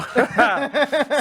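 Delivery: a high burst of laughter. Real, not acted. Laughing at accent mixup.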